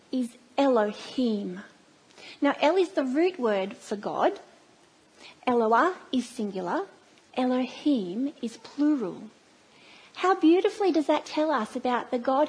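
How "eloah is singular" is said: normal talking voice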